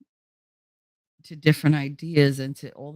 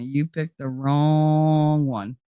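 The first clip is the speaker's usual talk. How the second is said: even slower, each word spaced out; slow menacing warning; much slower than usual. Retold or imitated. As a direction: drag it out to scare them off. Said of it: "Whenever anybody approaches me".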